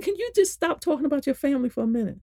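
high, fast, impatient plea; retold exasperated interruption. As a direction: cut the caller off, fed up. Retells interrupting the caller impatiently.

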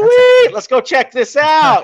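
high, loud burst of enthusiasm; excited. Retold or imitated. Buddy rallying friends to go explore, excited shout. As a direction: whoop it out to the gang. Retelling the group's eager reaction.

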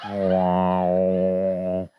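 deadpan deep wow like a crowd; mock awed wow. Comic drops a deep booming wow.

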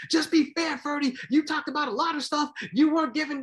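high, loud, ranting; angry Don King. Retold or imitated. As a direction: scold the commentator. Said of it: "Don King is wild".

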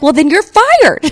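put-on high, slow bossy child; bratty kid voice. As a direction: play a nine-year-old firing someone. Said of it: "the kid me from the newspaper is like".

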